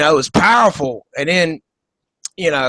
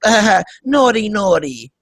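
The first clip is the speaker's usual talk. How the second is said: giggly mock Eastern European accent; Melania Trump; 3 semitones higher than usual. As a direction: tease coyly in a put-on Slavic accent. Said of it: "That's how she talks".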